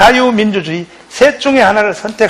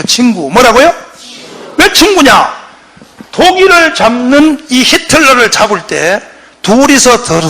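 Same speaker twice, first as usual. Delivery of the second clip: ordinary talk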